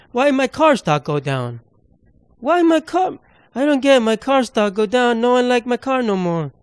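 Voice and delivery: high, mock-pouting little-kid voice; hammed-up baby-talk lament; whiny childlike Elon. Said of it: "It's like a, a twelve-year-old".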